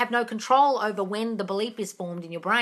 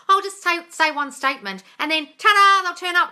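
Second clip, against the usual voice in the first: mocking Caleb; 8 semitones higher, 6 dB louder than usual. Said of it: "like Caleb says".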